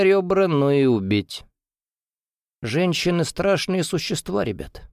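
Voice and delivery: put-on slightly slurred, hoarse drunk voice. A narrator's reading. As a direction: talk a bit drunk and husky. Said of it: "слегка заплетающимся языком и хрипловатым голосом".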